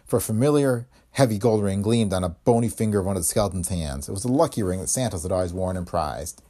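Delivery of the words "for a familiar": usual speaking voice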